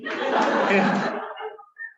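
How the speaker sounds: a burst of high, airless laughter; high breathy laugh. Real, not acted. Teacher laughing at his own class prank story, high breathy laugh.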